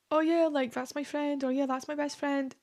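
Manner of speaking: a touch of mock gushing; lightly acted friendly claim. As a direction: pitch it up, as if casually showing off a friend.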